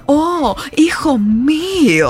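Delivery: slow, drawn-out lament of a father; a hint of a grieving elegant father; Gold Silver voice. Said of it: "Y Gold Silver".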